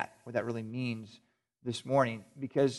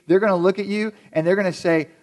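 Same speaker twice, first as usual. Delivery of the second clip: normal talking voice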